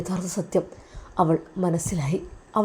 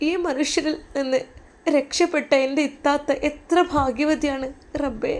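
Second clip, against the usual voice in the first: tearful prayer; 5 semitones higher than usual. Acted. Weeping prayer to God.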